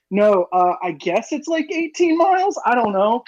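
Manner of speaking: high-pitched, hedging guess; unsure guess. Retold or imitated. Acts out his flustered answer on distance.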